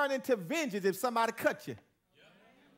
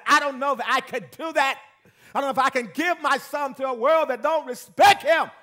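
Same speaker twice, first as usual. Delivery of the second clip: quoting the evangelist; 6 semitones higher, 10 dB louder than usual. Retold or imitated. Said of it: "She said".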